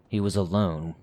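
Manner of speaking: ordinary delivery, nothing marked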